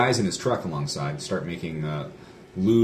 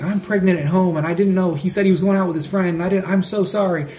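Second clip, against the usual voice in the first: much higher, put-on frantic woman; upset wife; 11 semitones higher, 6 dB louder than usual. Truck owner's wife apologizing on the phone, high imitated distress. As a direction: mimic a distraught pregnant wife. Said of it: "She's so angry. She's like".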